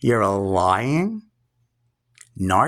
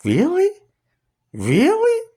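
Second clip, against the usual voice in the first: mock incredulous aunt. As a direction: ham up the aunt's scornful disbelief. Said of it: "just like this".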